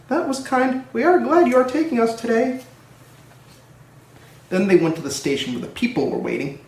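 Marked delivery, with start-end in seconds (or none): 0.1-2.6 high grateful carriage voice; coaches' voice. A narrator's reading. Coaches thanking Edward, high and grateful. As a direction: thank him in a high sweet voice. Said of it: "said the coaches".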